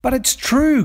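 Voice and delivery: high desperate screech. A narrator's reading. Cockatoo pinned by the dingo pleading with the birds, high screech. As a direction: screech it, begging to be believed. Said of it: "Cocky screeched".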